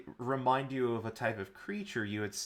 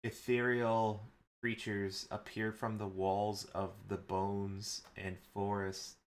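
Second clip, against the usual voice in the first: ordinary talk, nothing marked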